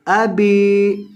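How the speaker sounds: अबी with the bi vowel drawn out slowly; long ba. Shown as a demonstration. Teacher shows students the one-alif lengthening on ba. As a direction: draw out the i in अबी. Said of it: "बा को लंबा करेंगे".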